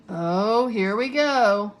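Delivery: slow, drawn-out bitter groan of words. A narrator's reading. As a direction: say it with weary bitterness. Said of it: "Nearly Headless Nick bitterly".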